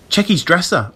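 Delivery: high, urgent command; hurried instruction; ordering. A narrator's reading. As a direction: order your friend to search quickly. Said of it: "I ordered".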